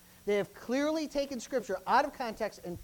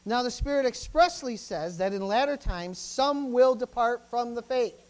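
loud, deliberate preacher reading; emphatic pulpit reading; forceful scripture proclamation; 3 semitones higher, 7 dB louder than usual. Real, not acted. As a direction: proclaim the verse, slowing and pressing at the end.

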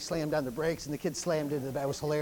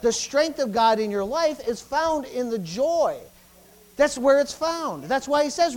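full-force preaching; 6 semitones higher, 9 dB louder than usual. Acted. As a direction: preach it hard to the congregation. Preacher exhorting congregation loudly.

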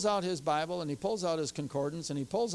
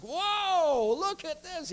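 high, thrilled whoa; excited whoa; much slower than usual. Retold or imitated. Pastor reading scripture, high and thrilled. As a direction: point at it like a treasure. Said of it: "I'm real excited".

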